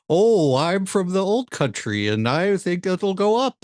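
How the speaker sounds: put-on high, slow old-timer voice; old-timey voice. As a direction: put on a creaky old-country storyteller. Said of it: "[name]'s old-timey voice".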